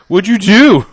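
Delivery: high, very loud mock outrage; mock accusing yell. Acting out an accusation over the broken door, mock yell.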